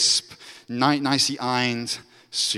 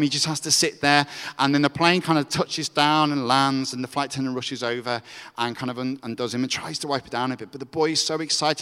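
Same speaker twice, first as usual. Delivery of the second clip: ordinary talk, nothing marked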